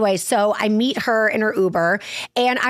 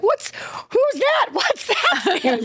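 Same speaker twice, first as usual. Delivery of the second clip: much higher, slow, bewildered cry; slow, high mock-confused mother's voice; confused mom; 10 semitones higher, slower than usual. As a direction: act baffled at the family dog. Amnesiac mom not recognising dog.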